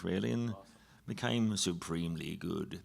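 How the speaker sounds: usual speaking voice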